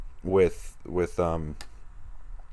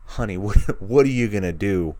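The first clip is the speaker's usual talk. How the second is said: louder, laughing, pitched a little up; excited teasing; 2 semitones higher, 5 dB louder than usual. Pretend. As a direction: tease her with a laugh as if she's right there. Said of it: "it's like".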